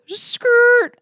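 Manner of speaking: a shrill, loud squeal of brakes, mouth-made; screeching tires. Retold or imitated. Shocked by his reply, she mimics a brake screech. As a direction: imitate a car screeching to a halt. Shocked reaction, almost wrecked car.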